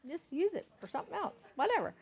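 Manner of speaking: a hint of a carefree Southern wife; breezy dismissive. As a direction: wave the complaint away with a shrug. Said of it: "And I'm like".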